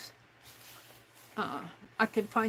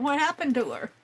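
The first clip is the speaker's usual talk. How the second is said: retelling her own surprise, louder and higher; surprised; 5 semitones higher, 5 dB louder than usual. Retold or imitated. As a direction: replay the startled question with more punch. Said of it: "I'm going".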